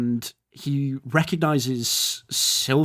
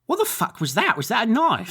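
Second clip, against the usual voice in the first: startled driver; 7 semitones higher than usual. Acted. GM voices startled lorry driver.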